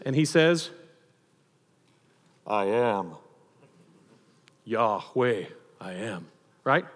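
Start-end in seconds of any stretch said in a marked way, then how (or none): 2.5-6.3 very low, measured declaration; low solemn voice, softening on the last words; solemn Jesus. Acted. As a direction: drop deep and pause between each utterance. Jesus answers the guards, acted solemnly.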